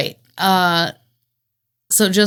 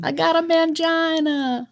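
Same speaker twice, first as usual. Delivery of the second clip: much higher, slow put-on voice; slow, high, creepy comic voice; Old Greg voice; 11 semitones higher than usual. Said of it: "what old Greg is".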